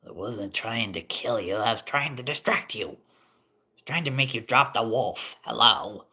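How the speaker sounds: a hint of an indignant goblin. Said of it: "the goblin's like".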